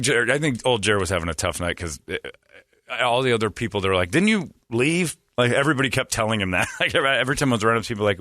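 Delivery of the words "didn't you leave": higher, drawn-out incredulous question; retold puzzlement. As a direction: imitate people puzzled to see him back. Others quoted, baffled at Jeremy's return.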